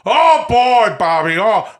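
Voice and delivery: big drawn-out actor impression; De Niro doing Al Pacino. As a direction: belt out the catchphrase as a hammy impression. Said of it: "This Al Pacino says".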